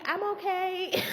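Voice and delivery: put-on high, sing-out kid voice; high-pitched mock reassurance from afar; imitated kid calling up. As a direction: call up to the window, mimicking a kid.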